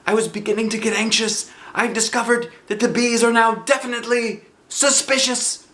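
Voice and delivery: high, bright bear voice calling out; Pooh's cheerful cry. Acted. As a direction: call like a happy little bear. Pooh voiced.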